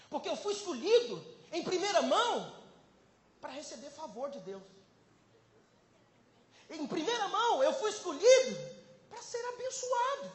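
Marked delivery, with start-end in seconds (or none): 0.1-2.6 high, loud preaching cry; impassioned proclamation. Real, not acted. Preacher declaring himself chosen, impassioned proclamation. 6.7-10.3 high, loud preaching cry; impassioned proclamation. Real, not acted. Preacher declaring himself chosen, impassioned proclamation.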